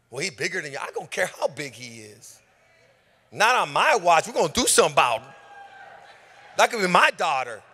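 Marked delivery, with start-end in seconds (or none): 3.3-7.6 loud, rising preacher shout; fired-up sermon climax; preaching at full force. Acted. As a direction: preach it with fire.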